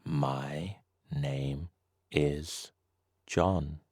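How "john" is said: ordinary talk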